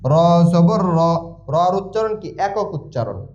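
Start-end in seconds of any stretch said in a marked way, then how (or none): 0.1-1.0 slow, measured syllable-by-syllable chant; spelling drill recitation. Shown as a demonstration. Teacher modelling Quran spelling for learners, drawn-out drill chant. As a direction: draw out each syllable on a level pitch.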